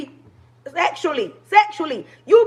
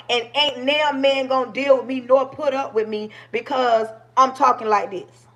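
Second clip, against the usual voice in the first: ordinary delivery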